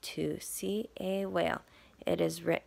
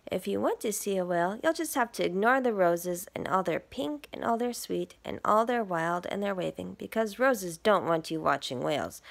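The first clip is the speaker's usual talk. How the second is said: lively picture-book read; reading to children; 4 dB louder than usual. Acted. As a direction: read it to little listeners. Reads picture book aloud.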